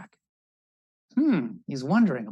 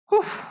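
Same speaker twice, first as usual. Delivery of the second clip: sharp high puff of relief; relieved whoosh; 7 dB louder than usual. Acted. Birds land after crossing the bay, a high relieved puff. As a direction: blow out relief at landing.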